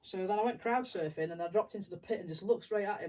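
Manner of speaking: ordinary talk, nothing marked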